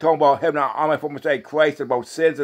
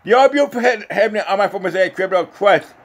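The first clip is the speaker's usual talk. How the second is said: high, raised opening of a psalm; psalm recitation; 6 semitones higher, 4 dB louder than usual. Real, not acted. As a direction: proclaim the holy name high, then ease down.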